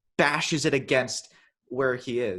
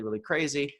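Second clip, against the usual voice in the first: ordinary delivery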